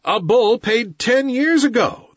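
high, loud sneering wolves; pack snarling. A narrator's reading. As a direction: snarl back as a scornful crowd. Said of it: "the pack snarled".

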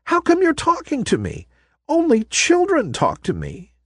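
high, amazed and puzzled; in surprise. Acted. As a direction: wonder aloud, astonished. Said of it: "said the ballerina in surprise".

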